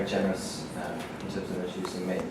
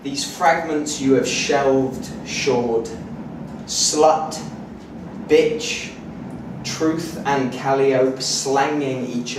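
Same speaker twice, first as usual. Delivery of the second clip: declaimed, measured poetry recital; poetry reading; 6 semitones higher, 12 dB louder than usual. Acted. Poet reads a collage of modernist fragments to an audience, declaimed. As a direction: read each fragment out deliberately with projection.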